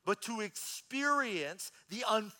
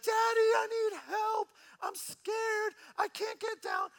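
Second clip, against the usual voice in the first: put-on very high, squealing little-girl voice; hammed-up, high-pitched child in distress; imitating scared child. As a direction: play a frightened kid calling for help. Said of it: "So she screams my name".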